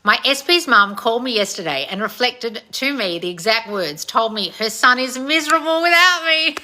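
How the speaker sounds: thrilled, climbing toward a laugh; gleeful excitement. Real, not acted. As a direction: read juicy news out with rising glee. Reads gleeful fan news aloud.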